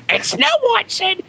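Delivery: very high falsetto storybook princess voice; female impersonation. Retold or imitated. Dad reading princess stories to his daughter, in falsetto impersonation. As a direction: badly fake a high-pitched regal lady. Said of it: "all my female impersonations sound pretty much the same".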